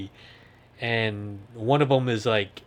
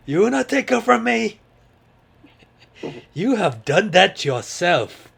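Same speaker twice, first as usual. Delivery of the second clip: put-on lame Cockney accent; 8 semitones higher, 4 dB louder than usual. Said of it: "my lame Cockney accent".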